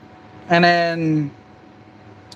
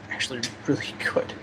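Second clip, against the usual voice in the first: whispered; 5 semitones lower, 10 dB quieter, faster than usual. Real, not acted. Eating hot food.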